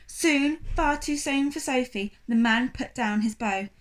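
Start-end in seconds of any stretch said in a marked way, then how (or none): none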